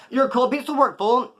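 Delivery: high, teasing reproach; mock scolding. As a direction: call out a friend in a high voice. Repeats his rebuke to friend.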